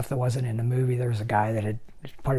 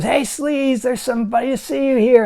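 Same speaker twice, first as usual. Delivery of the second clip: high, loud mocking holler; re-enacted taunting yell to someone inside; imitated calling out; 12 semitones higher, 8 dB louder than usual. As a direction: call through a door, making fun of him. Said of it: "pounds on the door and goes".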